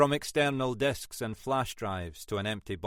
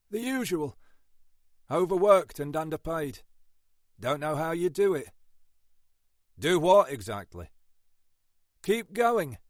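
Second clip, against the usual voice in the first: higher, lightly voiced weary copper; tired detective banter; 6 semitones higher, 4 dB louder than usual. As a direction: play a worn-out sergeant grumbling. Narrator voices Ashford's banter.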